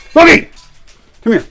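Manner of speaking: sharp loud high shout of the name; loud high call across the yard; urgent shout. Real, not acted. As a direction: yell to get the dog's attention. Calling dog urgently.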